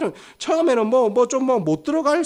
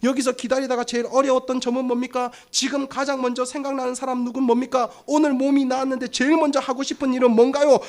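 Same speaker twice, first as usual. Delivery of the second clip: reporter interviewing winner; 3 semitones higher than usual. Acted. Reporters question the healed winner, mock press interview. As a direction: run of questions in an even, raised reporter tone. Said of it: "온 마이크 들이대고".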